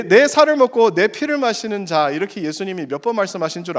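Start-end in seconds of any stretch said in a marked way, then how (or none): 0.0-2.1 emphatic quote. Acted. Preacher quoting Jesus loudly.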